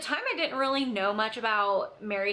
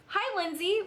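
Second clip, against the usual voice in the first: much higher, bubbly greeting; chirpy email voice; 10 semitones higher than usual. Retold or imitated. The saleswoman's email greeting, mock bubbly and higher. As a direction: read it like an overly sweet sales email.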